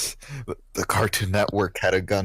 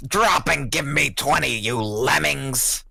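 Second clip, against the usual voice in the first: barking gym coach; 4 semitones higher than usual. Retold or imitated. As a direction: bark it like a drill coach. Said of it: "we hear Coach Gill's voice is like".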